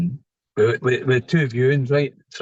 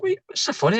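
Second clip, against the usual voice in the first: much higher, mock-baffled query; mock puzzled question. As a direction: squeak it out in mock confusion. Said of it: "I'm like".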